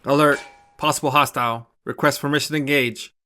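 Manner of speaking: a lightly voiced cockpit computer; robotic voice. A narrator's reading. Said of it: "robotic voice continues alerts".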